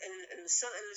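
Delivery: ordinary talk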